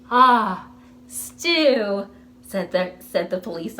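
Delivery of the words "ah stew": savoring stew. Acted. Officer mouth watering.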